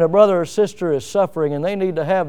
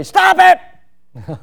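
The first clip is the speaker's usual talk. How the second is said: "Stop it!" barked much higher and louder; sharp command; 12 semitones higher, 5 dB louder than usual. Shown as a demonstration. Preacher contrasts a plain and a commanding "Stop it!". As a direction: snap "Stop it!" as an order. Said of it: "one's in the imperative and one's not".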